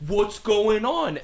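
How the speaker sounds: high, slow, bewildered "what's going on"; mock baffled whine; confused Shawn Michaels. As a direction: drawl it out high like a lost, baffled wrestler. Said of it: "Shawn Michaels is like".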